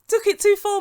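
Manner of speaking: high, loud scoffing repeat; indignant, pitched sharply up; incredulous echo. Real, not acted. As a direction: throw the excuse back in disbelief. Mocking echo of Michael's excuse.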